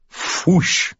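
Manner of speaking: breathy fwoosh of flames going up; whooshing fire noise. Acted. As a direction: mimic a blaze catching.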